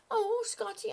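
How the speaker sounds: sudden oops on spotting him; dismayed realization. Real, not acted. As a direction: catch yourself, embarrassed. Apologising for missing a viewer.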